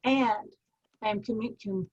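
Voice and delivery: slow, deliberate affirmation with long pauses; a calm, measured self-affirmation. Shown as a demonstration. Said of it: "So your command is".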